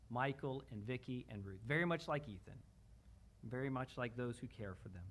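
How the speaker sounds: normal talking voice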